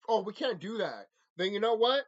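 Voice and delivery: usual speaking voice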